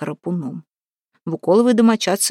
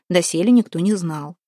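usual speaking voice

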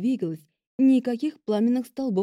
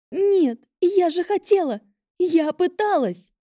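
высокий отчаянный крик; кричи сквозь слёзы, медленно; трагическое горе героини; 10 semitones higher, 5 dB louder, slower than usual. A narrator's reading. Вирджиния в отчаянии, рыдает. (отчаянный крик со слезами)

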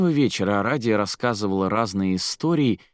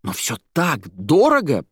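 high, slow, emphatic amazement; overexcited interrupting outburst; almost shouting; 9 semitones higher, 3 dB louder, slower than usual. A narrator's reading. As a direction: exclaim it, nearly shouting. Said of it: "он почти кричал".

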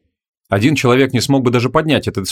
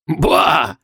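higher, dismissive scoff; contemptuous; 5 semitones higher than usual. A narrator's reading. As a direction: scoff it away as an old crank. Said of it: "презрительно отмахнулся Себастиус".

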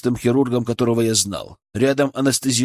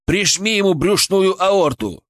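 urgent command; 7 semitones higher than usual. A narrator's reading. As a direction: bark the order slowly. Urgent order during resuscitation.

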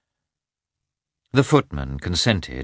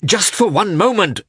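high, loud pleading; anxious husband pleading, high and loud; begging; 14 semitones higher, 6 dB louder than usual. A narrator's reading. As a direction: beg for just a moment. Lievin begging through the door.

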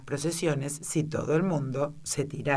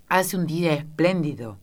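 plain reading voice

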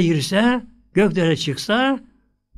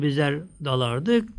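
ordinary delivery

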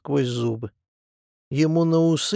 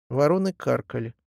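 ordinary delivery

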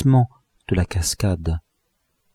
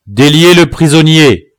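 loud, higher voice of command; shouted order; 5 semitones higher, 6 dB louder than usual. A narrator's reading. Chief ordering the prisoner freed, shouted order. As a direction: call out the order firmly. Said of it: "cria le chef".

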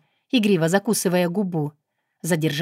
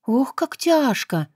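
dramatic sigh; 7 semitones higher, slower than usual. A narrator's reading. Narrator's mock lament.

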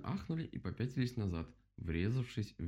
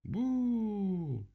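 long, high, loud boo; crowd yelling boo; 13 semitones higher, 5 dB louder than usual. A narrator's reading. All the animals condemn the owl, yelling boo. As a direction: boo it out at full voice. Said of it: "заорали все".

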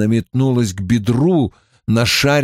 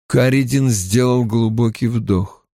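ordinary delivery, nothing marked

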